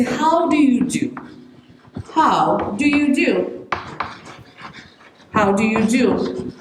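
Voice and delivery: slow, courteous how do you do; deliberate and respectful, each word clear; polite formal greeting. Shown as a demonstration. Meeting a superior, slow courteous how do you do. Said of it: "You say".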